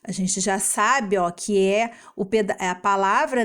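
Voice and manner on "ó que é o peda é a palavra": ordinary delivery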